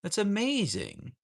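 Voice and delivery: high, drawn-out amazement; slow, high-pitched gush of admiration; gushing praise. Real, not acted. Streamer repeating praise to a viewer, amazed and gushing.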